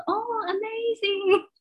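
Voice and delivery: polite fake amazement. Politely answering the overseas teacher, slow fake awe. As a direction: act impressed while not believing a word. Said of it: "可是我那时候说".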